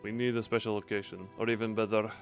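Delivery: ordinary delivery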